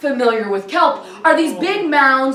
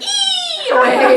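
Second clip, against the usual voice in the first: very high tiny squeal; squealing little crab; much slower than usual. Acted. Little crab fleeing after she lands on it, high squeal. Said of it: "Little crab's going".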